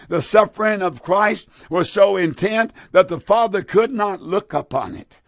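ordinary talk

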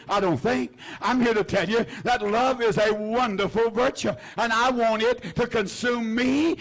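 ordinary delivery

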